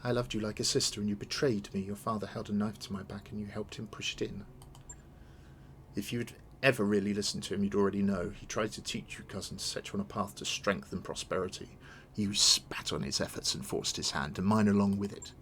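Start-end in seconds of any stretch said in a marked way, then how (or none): none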